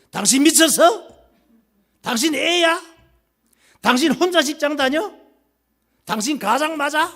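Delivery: 높고 큰 소리로 쏘아붙이는 아내; screaming wife. Acted. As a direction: shriek it at him, outraged. Wife yelling at husband. (남편에게 소리 지르는 아내, 높고 크게)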